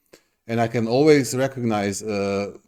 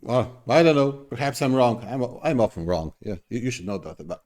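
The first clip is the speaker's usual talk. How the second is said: ordinary talk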